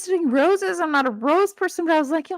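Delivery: high-pitched, giddy enthusiasm; excited gushing. Real, not acted. Reader swooning over the plant scientist, high excited gush. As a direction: gush in a high voice, squealing up at the end.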